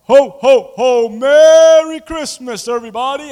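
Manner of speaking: loud, slow, booming Santa laugh; jolly ho ho ho. Retold or imitated. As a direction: boom it out like Santa Claus. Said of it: "had to play the role of Santa Claus".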